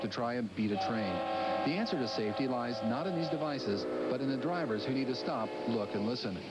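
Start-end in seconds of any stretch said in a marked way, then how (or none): none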